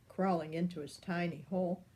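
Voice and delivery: ordinary delivery, nothing marked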